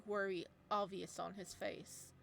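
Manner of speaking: plain reading voice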